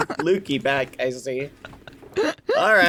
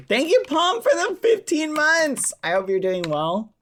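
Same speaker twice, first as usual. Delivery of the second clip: much higher, louder happy thanks; excited thanks; 5 dB louder than usual. Real, not acted. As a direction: beam out the thank-you. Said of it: "Out of character".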